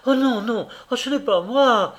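high, loud desperate denial; frantic high-pitched shout; crying out. A narrator's reading. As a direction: cry out in panic as she struggles. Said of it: "cria-t-elle en se débattant".

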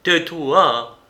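cry out. A narrator's reading. Marin covers his ears, crying out to silence her. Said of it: "s'écria Marin".

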